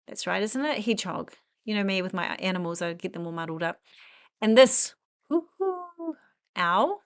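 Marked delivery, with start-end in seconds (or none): 5.3-6.2 owl hoot. Pretend. Imitating an owl toy.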